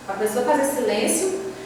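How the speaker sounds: ordinary talk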